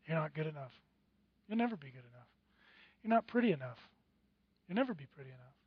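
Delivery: soft low nagging whisper of doubt; inner accusing voice. Acted. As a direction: let the doubts hum low and quiet. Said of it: "that dull, dull buzz".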